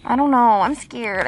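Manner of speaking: ordinary delivery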